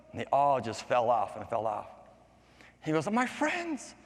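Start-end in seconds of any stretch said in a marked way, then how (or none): 3.1-4.0 high-pitched little lament; dismayed raindrop. Acted. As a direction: cry out high for lost friends. Raindrop laments lost friends.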